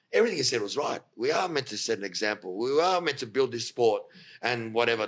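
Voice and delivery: ordinary talk, nothing marked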